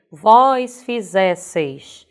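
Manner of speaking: "Vós fizesseis" very slowly, strong ZÉ; stress on ZÉ. Shown as a demonstration. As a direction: draw it out, leaning on fi-ZÉ-sseis. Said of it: "esse zé é paroxítona, sílaba forte". ("Vós fizesseis" devagar, com ZÉ forte)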